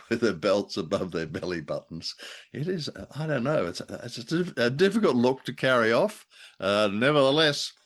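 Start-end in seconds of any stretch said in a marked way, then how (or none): none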